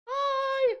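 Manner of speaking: high squeaky greeting; cute anime streamer. Acted. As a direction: chirp it like an anime girl. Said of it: "cute anime streamer greeting".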